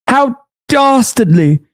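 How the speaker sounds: high, slow posh-Brit sarcasm; mock British. Sarcastic reply to the Brit, still in mock British. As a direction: fake fear in a high British voice.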